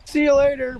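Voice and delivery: high, loud goodbye; cheery farewell in character. Acted. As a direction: call out a sing-out farewell. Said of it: "waves his hand".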